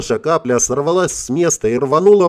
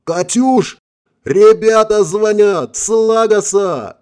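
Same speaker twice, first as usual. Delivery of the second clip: high, loud yell across the room; 9 semitones higher, 5 dB louder, slower than usual. A narrator's reading. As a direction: shout it out to call someone. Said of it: "заорал".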